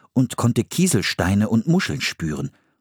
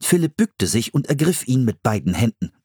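plain reading voice

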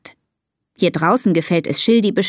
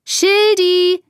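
high, drawn-out name call; long, high-pitched holler; loud call; 11 semitones higher, 3 dB louder than usual. A narrator's reading. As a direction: call out for a lost pet. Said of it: "ruft sie ganz laut".

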